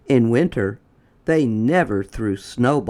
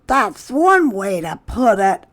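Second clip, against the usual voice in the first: put-on higher, louder boy voice; boy's voice; 9 semitones higher, 5 dB louder than usual. A narrator's reading. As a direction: agree high like a kid. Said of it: "said Wilson".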